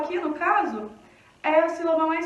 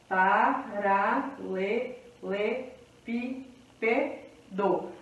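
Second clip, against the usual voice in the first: slow, lower "Paralelepípedo", a gap after each syllable; 5 semitones lower, 4 dB quieter, much slower than usual. Shown as a demonstration. Teacher separating the word for counting, syllable by syllable. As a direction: break "Paralelepípedo" into seven evenly spaced syllables. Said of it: "vamos separar silabi-, é, silabicamente".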